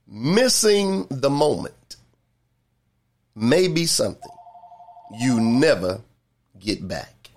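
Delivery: slow, deliberate phrases with long pauses; real slow. Shown as a demonstration. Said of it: "real slow".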